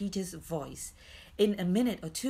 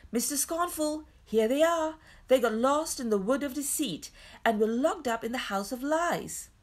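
high, louder announcing voice; Peter calling out; 6 semitones higher, 4 dB louder than usual. A narrator's reading. Boy reports found children to Mister Scornful, calling out. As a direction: hail someone, then report eagerly. Peter calls out his news.

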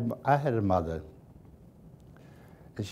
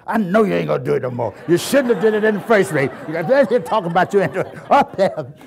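loud, higher, fast scolding; scolding grandma; 5 semitones higher, 7 dB louder than usual. Retold or imitated. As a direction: scold him like an angry grandmother. Grandmother scolding while whipping.

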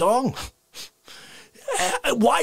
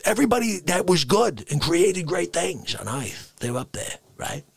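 usual speaking voice

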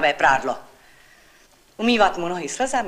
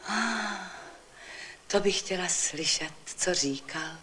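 softer wistful sigh; 9 dB quieter, slower than usual. Acted. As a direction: let the words ride out on a sigh. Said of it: "vzdychla paní Támár".

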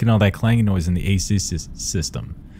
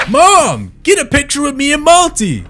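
put-on high, louder, eager child voice; a kid begging mom for a photo, pitched way up; excited kid; 7 dB louder than usual. Kid begging mom for a Monty photo, high excited child voice. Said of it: "kids are like".

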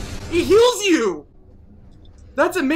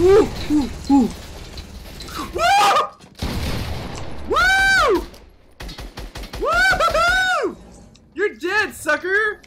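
victory whoop; 8 semitones higher than usual. Real, not acted. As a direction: celebrate the kill at full voice.

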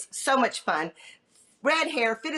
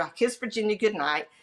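usual speaking voice

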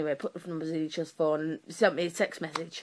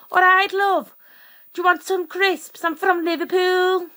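high, loud Scouse impression; Liverpool accent; 11 semitones higher, 8 dB louder than usual. Shown as a demonstration. Showing the only accent she can do, high loud Liverpudlian. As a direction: belt out a high-pitched, hammed-up Liverpool voice. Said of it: "The only one I can do is like this".

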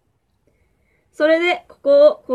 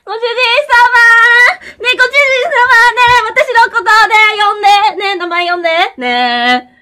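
loud, high needy maid voice; clingy maid skit; 4 semitones higher, 7 dB louder than usual. Pretend. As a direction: whine for attention as a maid. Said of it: "コント。もしもまゆがメイドカフェ". (しつこいメイドのコント)

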